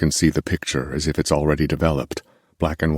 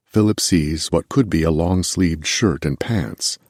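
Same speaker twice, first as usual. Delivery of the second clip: ordinary delivery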